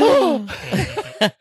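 big, high theatrical gasp; mock shocked gasp. Elle realizing about the shoes, exaggerated gasp.

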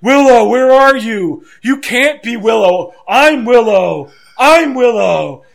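high, loud, drawn-out delirious wailing; hammed-up raving shouts; Jeff Hardy on narcotics. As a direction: imitate a strung-out wrestler calling wildly. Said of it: "sounds like he's on narcotics".

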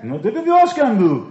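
ordinary delivery

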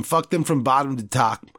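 usual speaking voice

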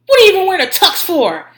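high, loud mocking yell; imitating a furious man's jab; tirade. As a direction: holler it mid-rant. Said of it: "in the middle of his tirade".

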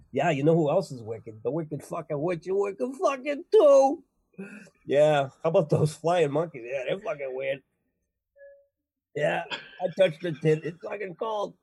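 broad Boston wise guy; Boston accent. Acted. Oz characters gripe about the witch and monkeys, thick Boston accent. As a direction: swear through it in thick Boston. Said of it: "have a Massachusetts accent".

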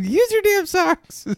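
high, slow mock-parent plea; exasperated mom. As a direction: laughingly scold in a mom voice. Parent scolding Danny, acted.

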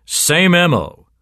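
slow, high, loud gloat; chortling cop. A narrator's reading. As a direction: crow it with relish, stretched out. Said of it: "chortled Sergeant Benway".

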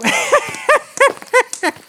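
high, loud teasing laugh; cheeky laugh. Acted. The narrator laughing at his flirty joke, high and loud. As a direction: laugh at your own joke.

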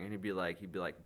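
normal talking voice

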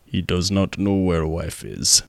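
put-on low, loud Bulgarian accent; deep, emphatic Bulgarian-accented English. Bulgarian guy translating about the missing wife, heavy Bulgarian accent. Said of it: "the Bulgarian guy is like".

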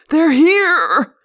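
loud, high-pitched frantic yell; hoarse shouting. A narrator's reading. Wild-eyed man warns the crowd, shouting hoarsely. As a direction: shout a wild warning to everyone around. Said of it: "the man cried".

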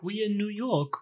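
soft, high mock German accent. Sweet German guy answering the gang, soft imitated German accent. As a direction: imitate an innocent German friend, softly. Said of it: "Max is just this sweet German guy".

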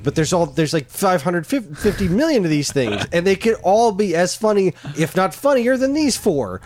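pleading high and loud; high exasperated plea. Retold or imitated. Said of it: "I'm like".

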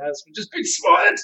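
high, loud panicked yell; mock scream. Streamer caught fishing badly by a granny, mock scream. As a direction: shriek it in pretend alarm. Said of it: "You just start screaming".

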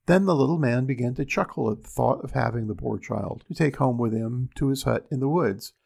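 plain reading voice

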